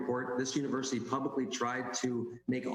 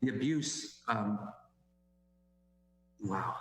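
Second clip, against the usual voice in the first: fighting tears mid-sentence; teary-eyed; slower than usual. Real, not acted. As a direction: voice catching, holding back tears. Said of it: "Sorry, I'm getting a little teary-eyed here".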